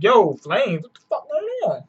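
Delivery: rising into a high, quick squeak; mock bewilderment. Sparrow seeing flames come out, mock high-pitched bewilderment. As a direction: voice the baffled Sparrow, jumping up high.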